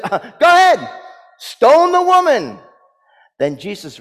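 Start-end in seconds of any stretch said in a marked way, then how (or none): 0.0-2.6 imagined command. Acted. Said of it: "and says".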